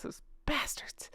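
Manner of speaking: whispered aside; whispering. Real, not acted. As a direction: hiss it under your breath. Sarcastic aside about royals.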